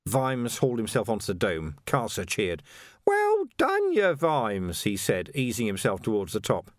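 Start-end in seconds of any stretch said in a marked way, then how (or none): 3.1-4.8 high, loud sarcastic cheer; mock cheer. A narrator's reading. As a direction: cheer him on mockingly. Carcer cheered.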